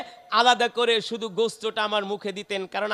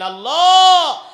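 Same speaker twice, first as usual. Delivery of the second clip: loud, high drawn-out cry to God, acted; preacher's imitation of a crying prayer, easing down at the end; imitated weeping prayer; 10 semitones higher, 11 dB louder than usual. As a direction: call out a mock-tearful plea, long first word. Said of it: "কান্না করতেছেন আর বলেন".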